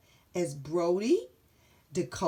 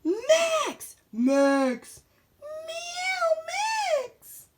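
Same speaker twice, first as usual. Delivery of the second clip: calling out; 6 dB louder, much slower than usual. Acted. Calling Max.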